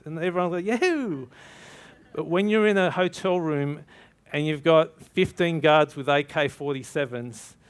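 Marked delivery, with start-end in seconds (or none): 0.6-1.3 higher, hammed-up yahoo; mock the easy church enthusiasm; cheering crowd, parodied.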